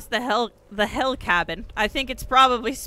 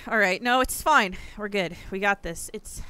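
ordinary delivery

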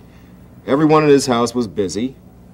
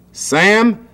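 high, loud shout; father barks to stop the typing; father shouting at Sam; 5 semitones higher, 6 dB louder than usual. A narrator's reading. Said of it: "shouted his father".

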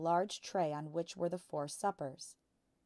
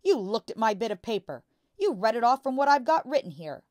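angry accusation; 6 semitones higher, 13 dB louder than usual. A narrator's reading. Father accusing Matilda.

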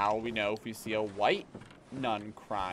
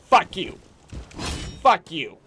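loud, higher-pitched yell, punching each word; furious shout; 6 semitones higher, 10 dB louder than usual. Real, not acted. Player screaming at the enemy attacking him, furious shout. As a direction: snarl it straight at the enemy.

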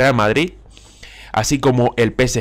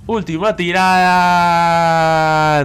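raised pitch, "tirada" held extremely long; drawn-out suspense; 5 semitones higher, 3 dB louder, much slower than usual. Real, not acted. Gamer on his last draw, long suspenseful cry. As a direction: hold the vowel of "tirada" through the spin. Final spin, stretched out.

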